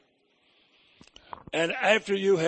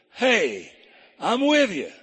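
lifted, louder congregation call; call and response; 5 semitones higher, 5 dB louder, slower than usual. Shown as a demonstration. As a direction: lead the crowd to answer back. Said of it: "Say that with me".

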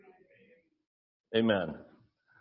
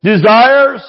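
preaching shout; 8 dB louder than usual. Real, not acted.

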